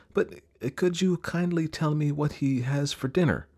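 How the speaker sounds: put-on polite child character. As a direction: ask politely as a curious young elephant. Elephant's child asks the snake politely.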